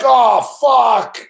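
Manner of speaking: loud, high cry of dismay; retold dismay. Acting out his dismay back then.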